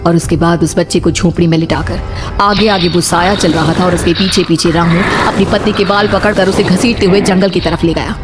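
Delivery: plain reading voice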